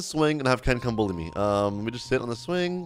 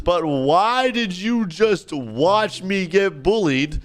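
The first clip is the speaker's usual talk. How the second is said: slow, higher, indignant scolding; indignant confrontation; 5 semitones higher, slower than usual. Acted. Victim confronting the bystander who recorded, indignant. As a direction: press each word in reproach.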